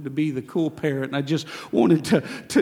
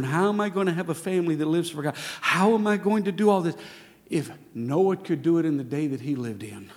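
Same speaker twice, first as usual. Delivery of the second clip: normal talking voice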